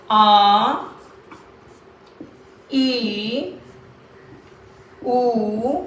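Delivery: आ, ई, उ each drawn out and held; sustained, separate vowel sounds आ, ई, उ; long vowels. Shown as a demonstration. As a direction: stretch आ, ई, उ one at a time. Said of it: "long vowels".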